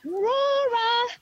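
high, drawn-out calling out; yelled call. Retold or imitated. Grandmother calling out for missing child, high searching call. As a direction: call a name far across the bush, long and high. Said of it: "I yelled out".

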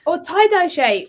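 high, loud and slow, drawn out; excited. Shown as a demonstration. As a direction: stretch it out with big excitement. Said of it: "So say it again".

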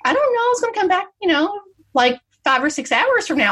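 high, airy mock voice; naive sister-in-law. Imitating the sister-in-law's clueless plan, high and airy. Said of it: "And she said".

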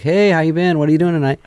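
high, mock-friendly imitation; chummy texter. Assistant texting the comedian's friend uninvited, imitated chumminess. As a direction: pitch it up like an overfamiliar pal.

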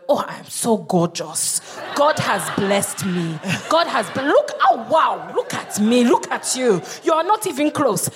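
put-on self-admiring Nigerian auntie accent; Nigerian women. Comic imitating Nigerian women admiring themselves, Nigerian accent. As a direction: draw it out like a proud Nigerian auntie. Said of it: "like, Nigerian women, they'll all be looking at themselves going".

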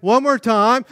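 loud, high rallying call; mock command to the group; imitated caller's shout. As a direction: call out like a camp leader. Acting caller calling out to campers.

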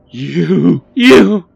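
loud, high, breath-starved stammer; losing her breath. A narrator's reading. Millie tries to accuse Barry, losing her breath. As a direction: stammer it, too shocked to finish. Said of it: "but lost her breath".

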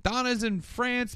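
high-pitched retold scolding; mock outrage. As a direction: imitate an indignant telling-off. Kelly confronting David, retold.

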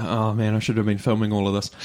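soft, low-key inner thought; muttered aside. Retold or imitated. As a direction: murmur a wry regret to yourself. Acted thought of bystander watching chaos.

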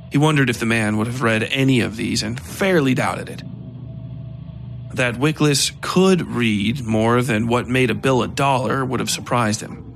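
ordinary delivery, nothing marked

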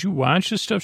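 a high, incredulous question; can't believe she watches that. Retold or imitated. Doctor amazed she watches TV.

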